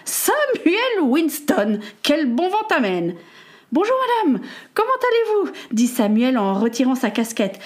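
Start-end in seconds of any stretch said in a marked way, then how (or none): 0.1-3.1 high, drawn-out exclamation on the name; loud exclamation. Acted. Peter's mother spots the visitor, high exclamation. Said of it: "plissa les yeux et s'exclama". 3.8-5.5 high-pitched polite greeting; polite boy. Acted. Samuel greets Peter's mother, high and polite.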